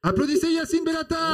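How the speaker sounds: high, loud showman announcement; announcer shout. Acted. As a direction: call the guest on like a host. Announcing a guest to applause.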